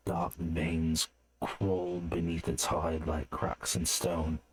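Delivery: usual speaking voice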